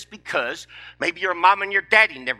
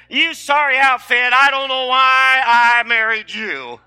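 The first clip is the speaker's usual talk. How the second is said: loud, scornful mock tirade, slower at the end; hammed-up insult from a wife, shouted; a wife belittles her husband in a mock shouting rant; nagging wife; 7 semitones higher, 7 dB louder, slower than usual. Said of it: "That's a whole lot better than".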